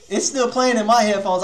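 normal talking voice

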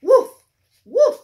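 loud, high playful woofs; dog barks. Acted. Teacher barking for Biscuit the puppy, loud and playful. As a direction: bark like a happy puppy.

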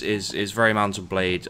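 usual speaking voice

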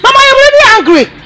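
angry. Acted. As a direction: shout it at your mother. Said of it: "why are you so angry".

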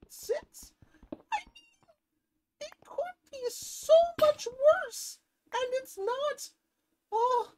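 a very high, cartoonish squeaky voice. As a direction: squeak it out like a tiny excited creature. Talking about iron count.

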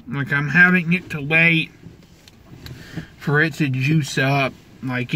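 talking around tobacco wad; chewing tobacco in cheek. Real, not acted. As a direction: mouth partly full. Seen 0: Wad taken from pouch earlier; cheek and lips look stuffed, distorted throughout.

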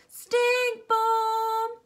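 high, loud sung-out alarm; child crying stink bomb across the hall; alarmed call. A narrator's reading. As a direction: sing out the warning. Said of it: "came the answer".

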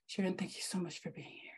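a soft, fast whisper of gratitude; whispered thanks. Real, not acted. Host thanking guest Sharon, whispered. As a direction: breathe the thanks out, barely voiced.

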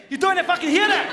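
high, loud angry yell; mock Glaswegian anger. Shown as a demonstration. Comic imitates an angry Glaswegian he can't understand. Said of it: "like he's a mate when he's speaking".

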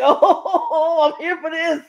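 high, laughing delight; excited. Retold or imitated. Fan retelling their reaction to the announcement, excited. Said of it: "I got excited".

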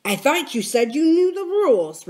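scornful, pitch swinging high; contemptuous. Acted. Silver reminds George of the rules, contemptuous. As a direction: put George down with lofty scorn. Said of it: "returned Silver contemptuously".

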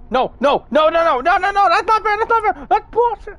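rising, outraged no no no; frustrated protest. Real, not acted. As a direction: climb into an indignant wail. Losing unfairly, protesting.

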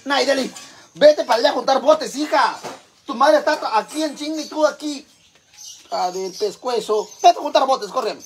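high, loud shooing command; calling out orders. Real, not acted. Mother telling Nayeli to go collect cans, loud scolding.

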